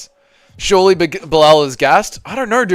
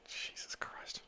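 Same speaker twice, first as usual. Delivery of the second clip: unvoiced breathy whisper; whispered disbelief; 35 dB quieter, slower than usual. Real, not acted. Streamer stunned by the fight, whispering. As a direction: breathe it out in shock.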